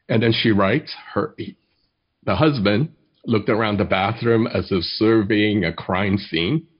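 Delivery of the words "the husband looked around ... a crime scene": ordinary delivery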